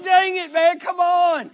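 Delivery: loud, high, frustrated outburst; acted exasperation. Dieter faced with cookies at work, acted exasperation. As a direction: cry out in mock exasperation. Acted reaction to repeated setbacks.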